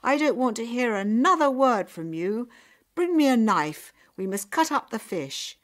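loud, high angry orders; shouting father. A narrator's reading. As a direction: shut down all argument and demand action. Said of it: "her father shouted".

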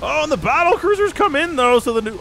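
high, urgent commentator cry; excited alarm. Real, not acted. Caster watching battle cruisers come in, excited alarm. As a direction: call the sudden arrival high, then break off.

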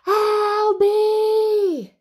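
high, loud drawn-out yell; cross sister's bellow; calling out. Acted. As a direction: holler the name from afar. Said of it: "came Mary's voice".

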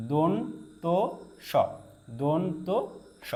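দন্ত slow as don-to, pause, then স; teacher's piece-by-piece দন্ত স; careful demonstration. Shown as a demonstration. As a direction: split দন্ত from স with a clear pause. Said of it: "দেখুন উচ্চারণটা".

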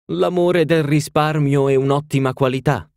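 complacent duchess. A narrator's reading. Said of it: "osservò la duchessa con compiacenza".

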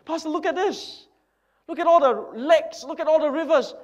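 loud, high bragging over his land; a proud landowner crowing over his wealth; boastful rich man. Acted. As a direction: show off every field you own. Boaster shows pastor his wealth.

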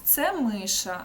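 ordinary talk

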